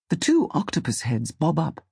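ordinary delivery, nothing marked

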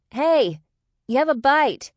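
high, loud call down a ship's deck. A narrator's reading. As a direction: call out urgently to someone far off. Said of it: "Ray called down the deck".

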